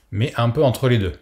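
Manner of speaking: ordinary delivery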